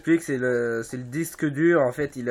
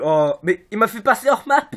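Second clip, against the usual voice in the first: frustrated outburst; 5 semitones higher, 6 dB louder than usual. Real, not acted. Frustrated at game glitch.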